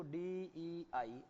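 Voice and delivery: "डीईआई" drawn out sound by sound; "डीईआई" as three slow, distinct sounds; spelt-out sounds. Shown as a demonstration. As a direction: slowly separate D, E, I in "डीईआई". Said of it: "इसको क्या बोलना है".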